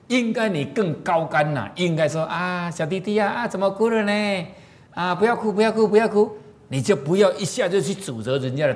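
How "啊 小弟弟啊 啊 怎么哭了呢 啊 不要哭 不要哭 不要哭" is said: put-on higher, gentle coaxing to a crying little boy; higher sing-song comfort for a crying child on the train. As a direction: soothe the small boy like an auntie.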